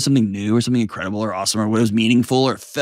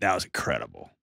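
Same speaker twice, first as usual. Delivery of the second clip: soft compliment; 14 semitones lower, 10 dB quieter than usual. Pretend. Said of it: "Hey, the other night".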